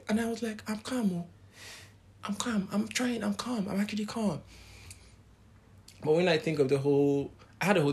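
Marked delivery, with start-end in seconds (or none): 0.7-4.4 high, strained, protesting calm; a tense claim of calm that fools nobody; insisting I'm calm. Retold or imitated. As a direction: pitch it up and insist nothing's wrong. Insisting she's calm despite high BP.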